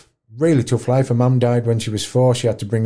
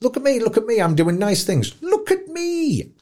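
mocking show-off; 12 semitones higher than usual. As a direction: sneer at the attention seekers, high and sing-song. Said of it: "the look at meers, as I would call them".

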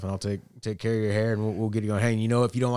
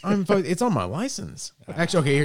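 higher, louder, laughing self-defence; playful protest; 9 semitones higher, 4 dB louder than usual. Real, not acted. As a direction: argue your case in a raised, excited pitch. Mock-indignant defence of his height.

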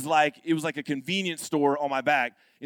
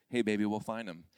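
low, soft, soothing reassurance; calm wife; 8 semitones lower, 7 dB quieter than usual. Retold or imitated. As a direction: imitate an unruffled wife, voice dropped low and quiet. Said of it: "My wife is just like this".

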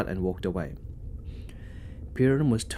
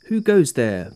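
loud, higher challenge in the dark; booming guard; 3 semitones higher, 6 dB louder than usual. A narrator's reading. Guard challenging a noise in the stables, booming. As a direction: boom it out like a guard on patrol. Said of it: "boomed the guard".

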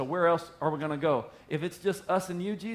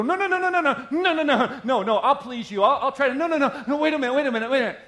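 high, loud, laughing frantic begging; mock panicked pleading; 10 semitones higher, 8 dB louder than usual. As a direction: beg them not to leave, hammed up. Mock pleading with leaving people.

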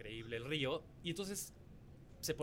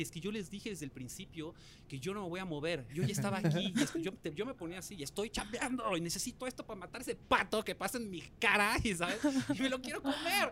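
building to a high-pitched rant; worked-up protest; 5 semitones higher than usual. Retold or imitated. Contestant explaining his crossbow hunt to crew, worked-up protest. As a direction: argue your case, getting more and more heated. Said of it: "Y le dije".

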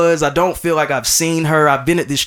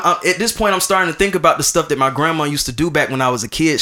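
ordinary talk

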